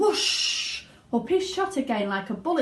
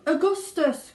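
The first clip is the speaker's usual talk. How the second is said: louder, higher call; father crying out; 9 semitones higher, 6 dB louder than usual. A narrator's reading. Father calling his son, louder and higher. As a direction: bellow his name. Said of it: "cried Mister Gloop".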